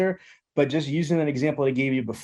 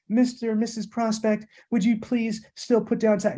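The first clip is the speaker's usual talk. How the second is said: higher, tentative pleading request; timid asking; 6 semitones higher than usual. Shown as a demonstration. Salesman showing the wrong, timid way to ask for taxes. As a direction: ask meekly, like begging a favor. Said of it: "I didn't say".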